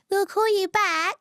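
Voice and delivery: parroting the staff's empty promise; mock call-centre promise. Mocking the call centre's fake promise, slow and high. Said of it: "when they say".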